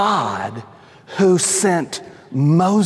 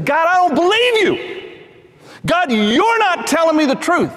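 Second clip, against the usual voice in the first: defiant Moses; 10 semitones higher than usual. Acted. Preacher plays Moses doubting God, high and accusing. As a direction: throw it back at God.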